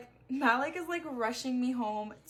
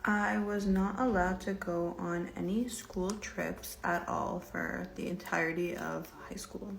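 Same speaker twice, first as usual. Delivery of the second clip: whispered voiceover; 4 semitones lower than usual. Acted. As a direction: lean in and murmur it like a get-ready-with-me clip. Separate soft whispery voiceover.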